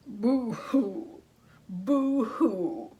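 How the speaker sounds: loud mock boo-hoo sobbing; hammed-up crying; wolf crying. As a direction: blubber like a big wolf. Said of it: "began to cry".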